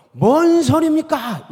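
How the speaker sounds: high, loud, slowed-down outraged objection; indignant protest. Retold or imitated. Peter protesting to Jesus, incredulous and loud. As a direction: act out Peter's shocked pushback at Jesus. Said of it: "이렇게 하는 겁니다".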